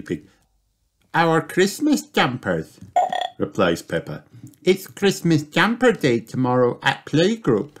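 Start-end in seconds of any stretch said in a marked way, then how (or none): 1.1-2.8 high slow little piglet voice; patient little girl piglet explaining to Daddy; Peppa's voice. Acted. 4.6-7.7 put-on patient little girl piglet explaining to Daddy; Peppa's voice.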